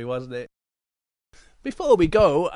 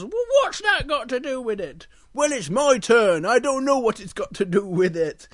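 higher, protesting cub; Little Bear voice; 6 semitones higher, 4 dB louder than usual. Acted. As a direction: argue back like an indignant little bear. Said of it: "And Little Bear goes".

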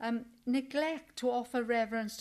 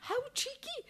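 laughing; 14 semitones higher, 4 dB quieter than usual. Real, not acted. Laughing at the cheek.

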